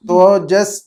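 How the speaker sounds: ordinary talk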